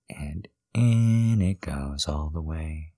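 slow soft whisper. Acted. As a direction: breathe it out slowly. Injection given, whispered.

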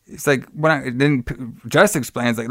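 ordinary talk, nothing marked

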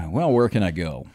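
usual speaking voice

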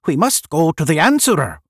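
loud, high-pitched urging; crying out. A narrator's reading. One urging the others to go, crying out. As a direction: call the group to action. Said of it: "one cried".

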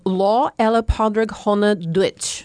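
Lá ella Pádraig Chonaic Duit said in Irish; Lá ella Pádraig Chonaic Duit, careful Irish pronunciation; Irish phrase. Shown as a demonstration. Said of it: "teach you how to say Happy Saint Patrick's Day in Irish".

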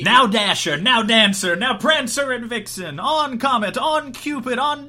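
high, loud dramatic poem reading; poem recitation. Acted. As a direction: declaim the poem with gusto.

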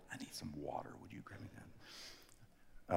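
soft whisper off-mic to a helper; real hushed aside. Real, not acted. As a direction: whisper a quick request so the hall can't hear. Aside to Glenn mid-sermon.

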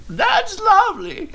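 put-on high, slow little old lady voice; sweet granny voice, drawn out. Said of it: "in an old lady voice".

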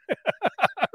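high chuckle; short amused laughter. Real, not acted. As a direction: laugh at your own story. Laughs after own story.

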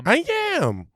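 high squeaky parroting voice; mocking imitation. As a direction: repeat the words in a goofy high voice. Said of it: "mimics S1's line".

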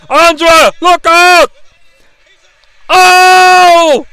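high, loud warning yell; alarmed shout. Real, not acted. Warning Andre from the couch, loud alarmed shout. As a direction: scream a warning at the screen.

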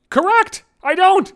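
very high, punchy agreement; emphatic agreement. Acted. Agreeing he needn't meet the wife, emphatically high-pitched. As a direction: pitch leaps up, driving the point home.

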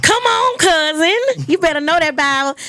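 high, loud come on cousin; excited cheer. Real, not acted. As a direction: hype up the champion. Host cheering contestant.

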